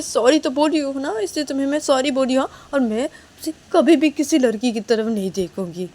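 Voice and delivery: earnest, insistent pleading; Neha's apologetic promise. Acted. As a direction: swear it will never happen, anxious to be forgiven. (कसम खाते हुए मिन्नत)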